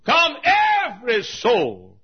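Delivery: high, loud, drawn-out altar call; shouted invitation. Real, not acted. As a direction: call every listener in at full voice. Calls out the invitation.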